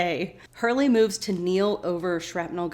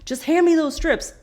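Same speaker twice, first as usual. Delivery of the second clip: louder, higher snapped command; impatient order; 8 semitones higher, 6 dB louder than usual. Retold or imitated. Jack orders Hurley during surgery, loud and impatient. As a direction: bark the order under pressure. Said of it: "And Jack is like".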